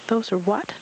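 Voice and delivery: high squeaky little voice; high eager squeak; squeaky Roo. Acted. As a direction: squeak it out like a small excited child. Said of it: "Roo squeaked".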